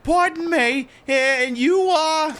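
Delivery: high-pitched mother, slowing on the question; wary mother. Acted. Mother asking a stranger who he is, high wary voice. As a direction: ask politely in a put-on high voice.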